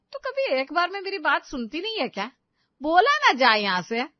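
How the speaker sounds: exasperated, rising to a loud order; angry scolding. Acted. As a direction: scold her, then loudly send her away. सास बहू को डाँटती है. (झुंझलाकर ज़ोर से डाँटना)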